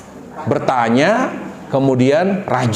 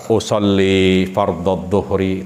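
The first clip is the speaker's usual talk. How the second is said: low, drawn-out recitation; worshipper reciting intention; 8 semitones lower, much slower than usual. Shown as a demonstration. Preacher demonstrates someone about to pray, low intoned recitation. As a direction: intone the prayer intention deliberately. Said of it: "Orang mau sholat".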